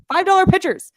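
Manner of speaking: high, punched-up ad shout; excited repeat. Real, not acted. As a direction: hype the bargain in amazement. Ad host marvels at repeated price.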